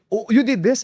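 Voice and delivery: high, loud astonished question; surprised. Retold or imitated. Boss amazed to learn he made the pages, surprised. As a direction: squeal it out in disbelief.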